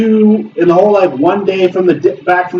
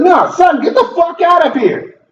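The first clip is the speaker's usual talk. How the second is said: mock Goku; 9 semitones higher than usual. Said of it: "He's like".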